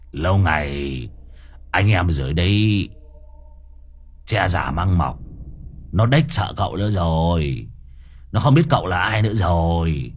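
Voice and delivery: low-pitched muttered complaint; grumbling. Acted. Sidekick doubts the master's power from behind him, grumbling. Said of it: "miệng nó lầu bầu".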